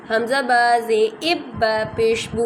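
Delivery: "حمزہ با زے اب با زبا" spelled out slowly, syllable by syllable; slow spelling out. Shown as a demonstration. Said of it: "دو دفعہ کیسے پڑھتے ہیں".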